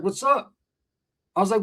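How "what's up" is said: high but unforced answer; casual reply. Retold or imitated. Imitating friend answering at school, casual reply. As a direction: toss the greeting back, no urgency.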